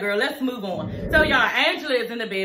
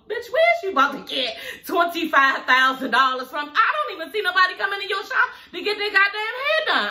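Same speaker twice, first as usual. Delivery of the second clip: laughing through a retold insult, pitch climbing high; retelling Angela's jab while laughing; laughing mock; 8 semitones higher than usual. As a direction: cackle while imitating the jab. Voicing Angela mocking her mother.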